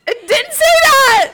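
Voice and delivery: high, loud protest, near tears of laughter; shrieking protest to the friend reading the rules; outraged shout. Real, not acted. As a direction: yell it in disbelief. Protesting the ice rule.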